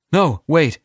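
a sudden flash of panic; trace of panic. Acted. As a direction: blurt it out, suddenly scared. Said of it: "the trace of panic".

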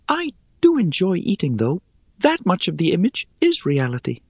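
high, airy, teasing spider; airy little voice. Acted. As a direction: toss it off lightly, amused.

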